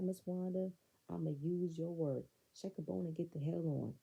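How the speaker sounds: ordinary talk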